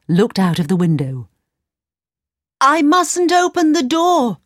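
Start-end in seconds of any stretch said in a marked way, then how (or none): 2.6-4.4 put-on high girlish cry; a young girl reminding herself. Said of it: "she cried".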